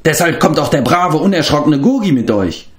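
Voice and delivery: fretful little beast boasting bravery; excited creature. A narrator's reading.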